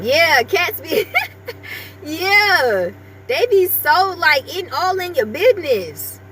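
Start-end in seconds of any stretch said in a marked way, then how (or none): 1.1-2.0 high airy unvoiced giggle; squeaky breathless laugh. Real, not acted. Vlogger giggling about nosy cats, breathless squeak. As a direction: let a laugh slip out.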